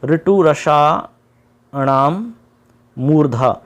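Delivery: sutra drawn out very slowly, syllable by syllable; slow recitation. Shown as a demonstration. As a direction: recite the rule slowly so students memorise it. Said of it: "क्या है?".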